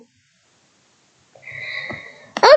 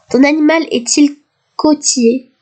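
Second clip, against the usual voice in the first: ordinary talk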